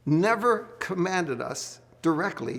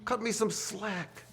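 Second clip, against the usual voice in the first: higher, slow mock whine; mock pleading; 7 semitones higher, 4 dB quieter, slower than usual. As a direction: beg for slack, half joking. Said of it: "I say".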